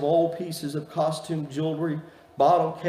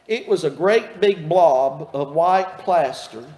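ordinary talk

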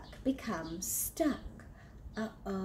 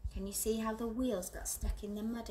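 usual speaking voice

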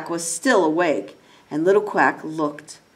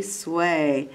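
usual speaking voice

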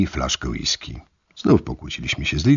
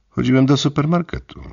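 lightly voiced innocent reply; 10 semitones higher, 3 dB louder than usual. Narrator quotes own answer to Lidia.

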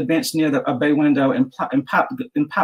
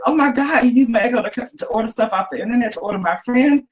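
shocked; 9 semitones higher than usual. A narrator's reading. Chris panics at the cat.

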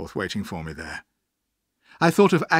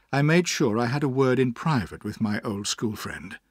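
plain reading voice

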